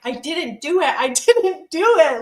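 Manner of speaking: laughing through a mock denial; laughing protest. Telling the host about the arrest, laughing protest. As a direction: protest your innocence while cracking up. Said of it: "And I'm like".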